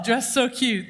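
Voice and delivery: normal talking voice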